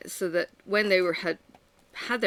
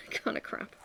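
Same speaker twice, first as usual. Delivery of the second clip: whispered aside; 9 dB quieter than usual. Real, not acted. Laughing aside to camera.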